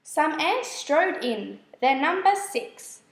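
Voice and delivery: ordinary delivery, nothing marked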